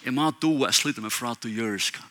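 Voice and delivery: ordinary talk, nothing marked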